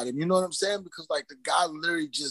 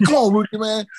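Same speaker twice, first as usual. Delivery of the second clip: loud, high mock coaxing; imitating a pushy pleader; pestering plea; 6 semitones higher, 6 dB louder than usual. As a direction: badger him loudly, pitched up. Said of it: "I was like that pestering person".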